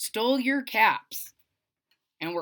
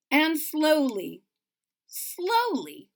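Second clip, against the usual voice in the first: drawn out; 4 semitones higher, slower than usual. A narrator's reading. Slowing narration.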